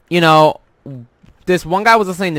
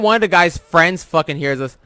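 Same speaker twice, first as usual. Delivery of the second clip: ordinary talk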